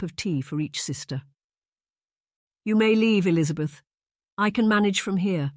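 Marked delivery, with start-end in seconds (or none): 2.6-5.5 distracted dismissal. A narrator's reading. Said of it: "Henrietta instructed distractedly".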